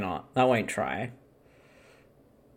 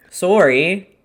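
playful mock Canadian accent. Echoing a friend's Canadian "sorry", mock Canadian accent. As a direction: echo it in a put-on Canadian accent. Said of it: "hesitate to do a Canadian accent".

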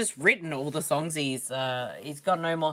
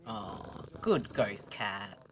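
softer, slow praise for a pet; soft slow pet talk; 7 semitones lower, 10 dB quieter, much slower than usual. Pretend. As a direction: coo at the cat slowly. Soothing the ghost cat.

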